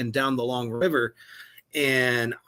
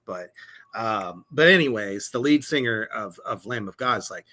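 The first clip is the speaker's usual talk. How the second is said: normal talking voice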